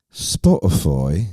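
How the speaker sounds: English accent. Shown as a demonstration. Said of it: "When you gotta say "foy".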